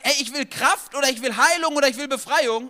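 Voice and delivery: loud, high put-on voice of a demanding Paul; loud, high mock demand; voicing Paul's demand. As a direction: play Paul demanding his wishes loudly.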